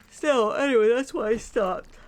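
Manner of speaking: high, loud words pushed through a yawn. Real, not acted. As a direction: keep talking while you yawn. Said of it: "I don't know why I'm yawning".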